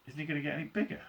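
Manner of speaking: usual speaking voice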